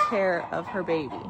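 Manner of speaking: normal talking voice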